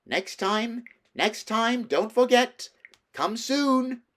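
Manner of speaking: high, loud call after him; queen calling from a departing sledge. A narrator's reading. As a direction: wave and call across the snow. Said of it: "calling out".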